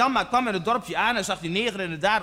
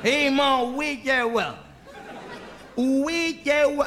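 badly faked Surinamese accent; 3 semitones higher, slower than usual. Surinamese man confronting Janmaat, in a poor Surinamese accent. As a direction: attempt a clumsy Surinamese accent. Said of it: "een accent is nooit mijn sterke kant".

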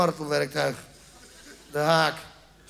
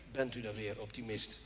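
mocking mutter; 11 semitones lower, 14 dB quieter than usual. Repeating the dentist's greeting mockingly.

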